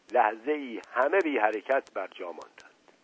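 usual speaking voice

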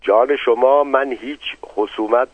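ordinary delivery

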